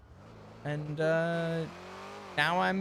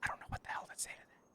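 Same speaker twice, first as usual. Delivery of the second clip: whisper; 5 semitones lower, 10 dB quieter, faster than usual. Real, not acted. Whispering back on the call.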